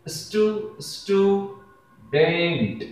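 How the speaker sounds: स्टूडेंट drawn out slowly as स्टू… डेंट; syllable by syllable. Shown as a demonstration. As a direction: stretch both halves of स्टूडेंट, restarting on स्टू. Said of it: "इस तरीके से जब आप देखते हैं".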